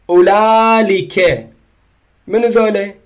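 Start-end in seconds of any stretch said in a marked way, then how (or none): 0.1-1.5 أولالك stretched slowly, with a clear L for the hamza; slow demonstration of أولالك with an L; lam replacing hamza. Shown as a demonstration. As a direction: draw out أولالك syllable by syllable, lam not hamza. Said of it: "مكان الهمزة يخلون لام".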